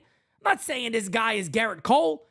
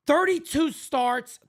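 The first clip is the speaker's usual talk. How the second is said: ordinary talk, nothing marked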